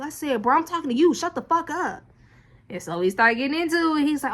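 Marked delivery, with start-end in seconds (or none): 0.4-2.0 high, loud retold telling-off; angry. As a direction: tell him off hard. Retold argument with man.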